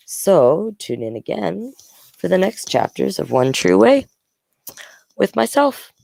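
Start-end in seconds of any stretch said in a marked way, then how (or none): none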